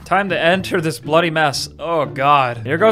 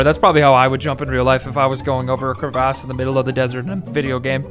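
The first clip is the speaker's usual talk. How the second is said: ordinary delivery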